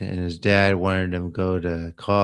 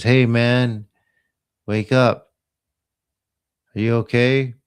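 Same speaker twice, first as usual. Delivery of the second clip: calling out; 4 semitones higher, 5 dB louder than usual. Retold or imitated. Waking an unresponsive man.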